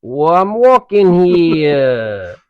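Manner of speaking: loud, high, drawn-out Chicago holler; hammed-up Chicago street shout; mock Chicago accent. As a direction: bellow it slowly in a bad Chicago accent. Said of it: "Can you do a Chicago accent".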